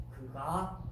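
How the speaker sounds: normal talking voice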